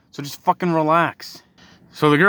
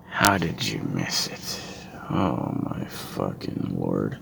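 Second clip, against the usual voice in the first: very low, slow disbelief; sinking low in despair at stupidity; weary exasperation; 6 dB quieter, slower than usual. Real, not acted. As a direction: drag it out, deep and fed up. Exasperated answer after the impression.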